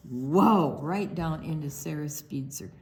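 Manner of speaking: plain reading voice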